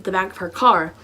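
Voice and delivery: ordinary talk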